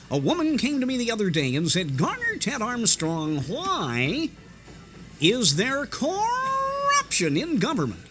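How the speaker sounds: imitating Garner Ted Armstrong's elastic voice; rubber band preacher voice. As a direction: swoop the pitch up and down like a radio evangelist. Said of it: "rubber band kind of voice".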